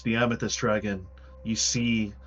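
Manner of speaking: ordinary delivery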